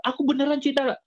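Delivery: high-pitched, hammed-up declaration of love; exaggerated lovestruck protest; mock lovesick suitor. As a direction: imitate a pleading suitor, pitched well up. Mocking a lovesick suitor's plea.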